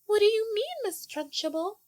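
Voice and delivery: put-on high timid little girl. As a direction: squeak it like a small child. Little girl answers Trunchbull.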